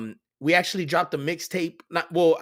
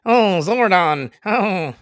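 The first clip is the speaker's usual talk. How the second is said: louder, drawn-out Zordon call; dramatic robot cry; 3 semitones higher, 6 dB louder, much slower than usual. Retold or imitated. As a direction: wail it like a TV robot. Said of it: "same words said twice".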